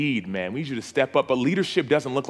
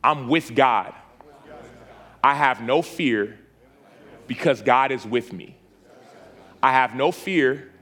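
call-and-response prayer. Acted. Pastor leading the men in a repeat-after-me declaration.

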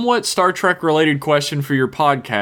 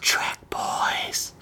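long, soft whisper; hushed, drawn-out echo; whispered catchphrase; 7 dB quieter than usual. Pretend. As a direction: breathe the words out slowly.

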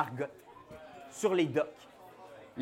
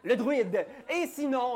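ordinary talk

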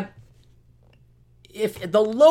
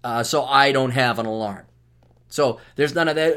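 ordinary talk

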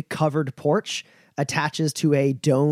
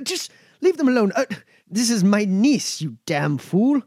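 scolding the guard; 7 semitones higher than usual. Acted.

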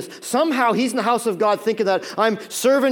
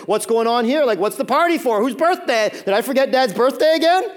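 louder, higher, questions rising in bewilderment; puzzled older son; 5 semitones higher, 6 dB louder than usual. Acted. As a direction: demand to know what all the fuss is about. Older son acted, puzzled by party.

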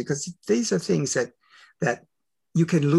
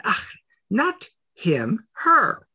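"her" shot up high and loud, "Ach, not him" slow; slow "not him", then pop "her" way up; correcting him, landing hard on "her"; stress on her; 7 semitones higher, 3 dB louder, much slower than usual. Retold or imitated. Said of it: "I said".

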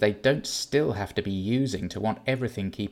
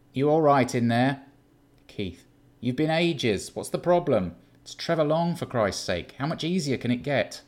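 higher, louder, impatient flatmate voice; impatient Keith; 5 semitones higher, 7 dB louder than usual. Acted. Keith calls through the door, impatient and loud. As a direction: badger him through the bedroom door.

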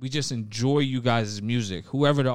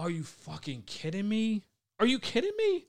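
high-pitched, incredulous outburst; real incredulity; 9 semitones higher than usual. Real, not acted. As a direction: shoot up high in disbelief. Disbelief that co-host doesn't watch Snowfall.

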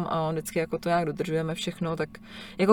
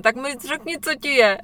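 retold yell; 6 semitones higher than usual. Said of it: "Vždycky řve".

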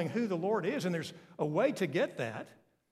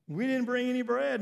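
higher, louder mock whine; mocking disciples; 6 semitones higher, 4 dB louder than usual. Preacher mocking the disciples' bread worry, higher and louder. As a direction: parody the worried disciples. Mock repeat after laughing.

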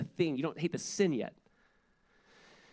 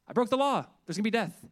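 higher, louder alarmed cry; panicked mock dread; 6 semitones higher, 4 dB louder than usual. A sinner repenting like at Sinai, in loud mock panic. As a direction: blurt it out in mock terror of punishment.

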